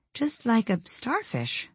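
soft, slow inner thought; thinking aloud softly. A narrator's reading. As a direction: murmur to herself. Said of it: "thought Alice".

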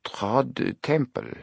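put-on very slow, French-accented echo of a name; drawn-out, quizzical French manager. A narrator's reading. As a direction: repeat it doubtfully in a French accent. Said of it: "spoke with a French accent".

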